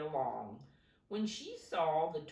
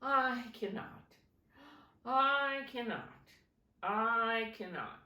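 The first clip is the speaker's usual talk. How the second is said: chuckling refusal; 6 semitones higher, 4 dB louder than usual. Acted. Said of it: "rumbled off to the brown house chuckling".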